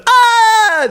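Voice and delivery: loud, very high screech; sudden piercing screech. Shown as a demonstration. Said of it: "I screech a lot".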